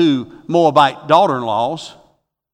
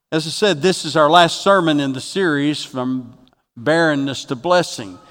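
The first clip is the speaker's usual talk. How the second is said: ordinary delivery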